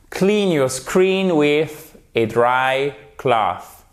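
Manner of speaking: very slow, each word laid out for learners; slow model sentence. Shown as a demonstration. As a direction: Clean your screen with a dry cloth, spoken slowly for repeating. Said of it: "teacher models the sentence". (phrase modèle dite très lentement)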